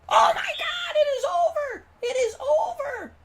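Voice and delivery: high, loud screaming of disbelief; ecstatic shout. Real, not acted. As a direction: yell it as the stand erupts. Goal-line stop, screaming.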